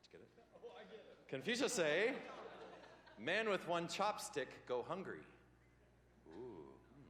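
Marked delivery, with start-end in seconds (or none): 1.3-5.2 hammed-up Chinese accent, higher and louder; mock Chinese accent with a laugh; joking Chinese-accented proverb, higher pitched; mock Confucius accent. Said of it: "I will talk like this".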